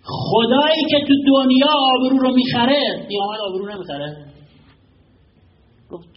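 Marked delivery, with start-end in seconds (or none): 0.0-4.3 impassioned preaching. Acted. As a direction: cry out the plea to God. Preacher's climactic plea. (موعظهٔ پرشور با فریاد)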